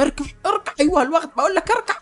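high-pitched, hammed-up villain orders; comic squeal of failing authority; frantic commanding. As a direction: insist desperately that they kneel.

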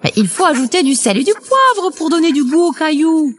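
voix de poule aiguë et rapide; débite les consignes vite, haut perché; mère poule affairée. A narrator's reading. (poule de conte pressée, voix aiguë)

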